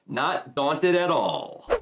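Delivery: slow, lightly put-on pout; Ashido pouting. Ashido chiming in after Tokoyami, slow mock pout. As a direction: draw it out with a slight pout. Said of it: "we got a pouty face Ashido chiming in to say".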